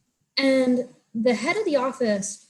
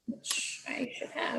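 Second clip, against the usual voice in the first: whispered; 2 semitones higher, 11 dB quieter, slower than usual. Real, not acted. Aside under breath.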